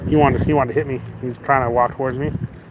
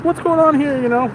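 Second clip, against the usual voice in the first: mock officer; 13 semitones higher than usual. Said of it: "And he's like".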